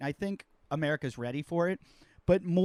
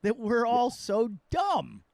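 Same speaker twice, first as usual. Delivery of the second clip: exasperated whine; 7 semitones higher, slower than usual. Retold or imitated. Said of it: "And I'm like".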